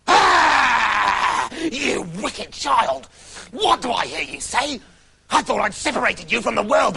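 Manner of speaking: the witch screeches at Rapunzel; furious witch. Acted. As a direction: hurl it at the child like a hag. Witch discovers the prince.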